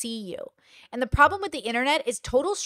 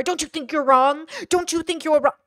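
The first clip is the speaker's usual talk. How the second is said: louder, higher mock badgering; leading questions; 4 semitones higher, 5 dB louder than usual. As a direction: push the loaded question like a pestering internet critic. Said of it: "people will do leading questions".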